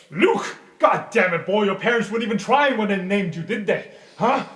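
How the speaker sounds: mocking rant. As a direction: berate the boy scornfully. Professor berates student.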